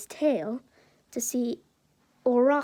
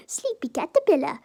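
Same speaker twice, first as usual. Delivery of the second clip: higher-pitched tease after a giggle; giggle, then tease in a silly raised voice; giggly teasing; 4 semitones higher, 5 dB louder, faster than usual. Acted. Izuku giggles at sleepy Aizawa, giggly teasing. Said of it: "he kinda giggles like at this".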